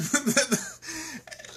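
high, helpless burst of laughter; frustrated laugh. Real, not acted. Talking to Mike about doomed dogs, a frustrated high laugh. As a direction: laugh so you don't cry. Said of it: "I'm laughing 'cause I can't, I wanna cry".

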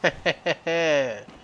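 mock-villain cackle; staccato laugh of triumph; gloating laugh. Gloats over seizing the technology.

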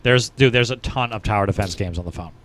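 usual speaking voice

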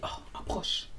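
retold hushed whisper. The guy pulling her close, whispered. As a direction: lean in and whisper it. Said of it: "il me dit quoi dans les oreilles".

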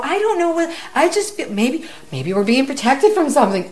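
high-pitched, searching for a reason; starts high, eases down while guessing; flustered protest. Retold or imitated. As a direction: defend yourself to a child without knowing why. Retells flustered answer to her son.

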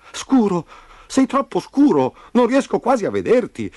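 put-on high, loud boy's call; exclaiming. A narrator's reading. Memo peering at Roby in the hole, exclaiming. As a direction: exclaim it down to your friend. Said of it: "esclamò Memo".

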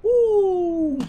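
high, loud whoop of relief; excited whoop. Real, not acted. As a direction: cheer as the jump finally lands. The jump lands.

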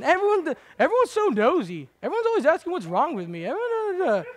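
high, loud mock whine; whiny complainer. Preacher mocking a complainer, whiny high voice. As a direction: mimic a nosy-neighbor complainer.

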